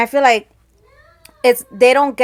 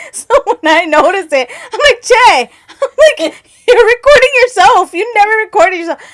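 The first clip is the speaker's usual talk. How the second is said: high, loud laughter through every word; gasping with laughter while calling out to him; laughing hard; 12 semitones higher, 10 dB louder than usual. Real, not acted. Calling out to cousin Che about his live, laughing. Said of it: "Me and Jackie were laughing so hard".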